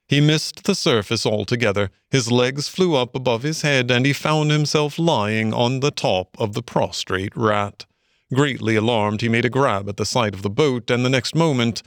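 ordinary delivery, nothing marked

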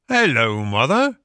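hearty greeting lifting in pitch; friendly engine driver. A narrator's reading. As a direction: hail an old woman kindly.

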